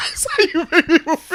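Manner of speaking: high, laughing parrot of the co-host; mocking imitation. Host mimicking co-host's solemn remark, laughing mockery. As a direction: throw the line back in a silly high voice. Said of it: "Why you gotta talk like that".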